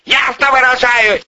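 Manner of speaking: high, mounting outburst; climbing, high-pitched exasperation; exasperated anger. A narrator's reading. Boss loses patience with subordinate.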